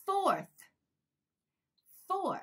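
"fourth" carefully ending in th with the tongue; tongue-made final th. Shown as a demonstration. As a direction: model "fourth", releasing a clear final th. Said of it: "四番とth". (最後のthに舌を使って「fourth」と発音)

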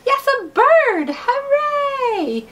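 high, loud happy cheer; excited cheer. Acted. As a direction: cheer it out to the child. Cheering a bird book to child.

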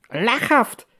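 high-pitched mocking yelp; screeched scoff. A narrator's reading. Fergus scoffs at his sister's news, screeching. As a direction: shoot it out high and scornful. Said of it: "kreischte Fergus".

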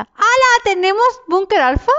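high, loud burst of surprise; astonished. Real, not acted. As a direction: exclaim in amazed delight. Finds unexpected bunker card.

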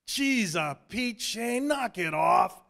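slow, shouted fake anger; mock anger. A narrator's reading. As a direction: pretend to be mad at him. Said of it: "I tried to sound angry".

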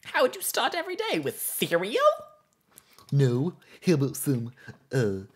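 high, loud, goofy cartoon moose; dopey cartoon moose, pushed up high; Bullwinkle moose voice. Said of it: "Carmel's voice is very much like a Bullwinkle moose voice".

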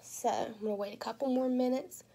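ordinary delivery

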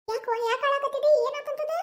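put-on a mother rushing in alarmed to a crying girl; mother startled, asking why she cries; worried mother. Mother finds daughter-in-law crying.

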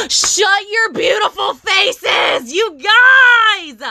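real ecstatic scream, drawn out; excited yelling. Real, not acted. Vlogger thrilled at what she unboxed, excited yelling. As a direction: stretch it out, ending in a loud high scream. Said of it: "Sorry, I yelled".

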